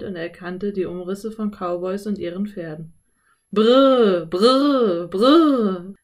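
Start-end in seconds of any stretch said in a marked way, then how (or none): 3.5-5.9 loud, long trilled "brrr"; shouted "brr" to halt horses. A narrator's reading. Cowboys halting their horses, loud trilled call. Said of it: "riefen die Männer".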